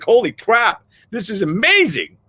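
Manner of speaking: a happy "holy crap" at a lucky break; excited amazement. Retold or imitated. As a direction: gush like the day just went perfect. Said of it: "You're like".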